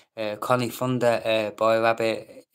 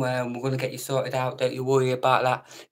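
normal talking voice

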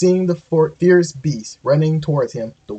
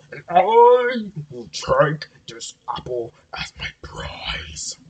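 bellowing bull moose; 2 semitones higher, slower than usual. A narrator's reading. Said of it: "bellowed the bull moose".

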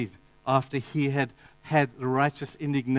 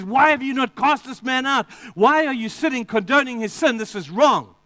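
Paul's angry rebuke; 7 semitones higher, 3 dB louder than usual. Retold or imitated. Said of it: "he gets really angry".

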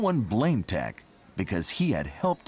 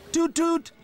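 high, loud toot toot; tooting horn; 4 dB louder than usual. Acted. Tractor sounding horn.

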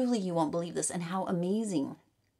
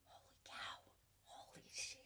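awed, much softer whisper; 22 dB quieter than usual. Real, not acted. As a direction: breathe it out in awe. Awed by the cards.

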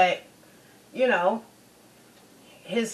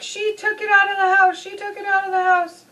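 put-on tattling kid; 10 semitones higher, faster than usual. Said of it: "is like".

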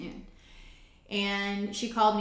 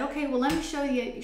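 higher-pitched, lightly imitated host; placating offer; 7 semitones higher, 3 dB louder than usual. Animal owner offering a visitor the others, placating. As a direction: retell her eager offer in a higher voice.

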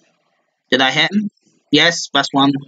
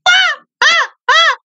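shrill squeals; 4 dB louder, slower than usual. Real, not acted. Ecstatic squeal.

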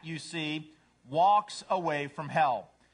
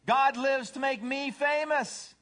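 high, loud, slow bragging; self-centered cat; 10 semitones higher, 5 dB louder, slower than usual. Acted. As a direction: announce it grandly, pitched way up. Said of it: "A cat says".

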